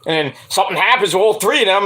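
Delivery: ordinary delivery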